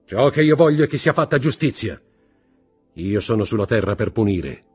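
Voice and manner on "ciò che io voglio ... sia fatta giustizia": loud, high declaration of judgement; stern proclamation. A narrator's reading. Judge husband refuses his wife mercy, sternly. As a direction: proclaim it forcefully, unyielding.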